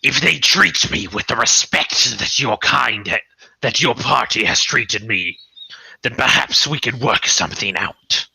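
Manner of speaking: measured, formal talking wolf; wolf mother's voice. Acted. Wolf mother bargaining with the party, put-on stately voice. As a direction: speak as a wary beast striking terms. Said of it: "sit there and stare at you and, and go".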